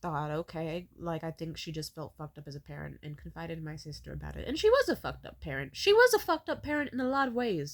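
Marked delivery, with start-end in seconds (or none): 4.5-7.7 loud, raised, emphatic condemnation; real indignant outburst. Real, not acted. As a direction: let the resentment burst out, high and loud. Bitter outburst about her mother.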